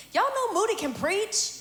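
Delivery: high, loud playful hype with laughter; rally the crowd to cheer; excited hype. Real, not acted. Host hyping the guest to the audience, laughing.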